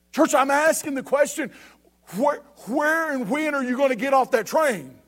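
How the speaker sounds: preacher driving the words home; forceful preaching. Real, not acted. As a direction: press the congregation with urgent conviction.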